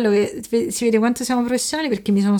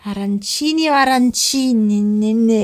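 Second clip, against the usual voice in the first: drawn-out playful taunt; slow teasing; much slower than usual. Pretend. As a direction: stretch it out to tease. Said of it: "teasing repeat, slowed".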